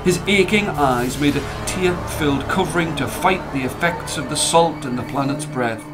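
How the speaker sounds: usual speaking voice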